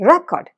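Record with the first part റെ hit; record, weight on റെ; stress on RE. Shown as a demonstration. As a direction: say record as RE-cord, noun. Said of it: "അതെന്താ അർത്ഥം".

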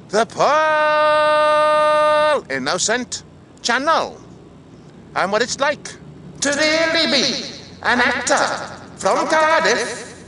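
big echoing show-title holler; shouted sign-off. Acted. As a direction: belt out the show title, stretching the name.

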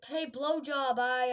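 ordinary delivery

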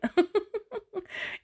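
high laughing brothers; high teasing laugh; mocking laugh. A narrator's reading. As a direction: laugh at the little snail. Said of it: "his brothers were laughing out loud".

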